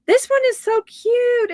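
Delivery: thrilled by the cute gift; excited squeal. Real, not acted. Gasping at a gift sent by a viewer, thrilled and high.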